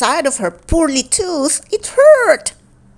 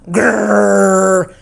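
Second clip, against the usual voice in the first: growl grrr. A narrator's reading. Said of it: "came the noise again".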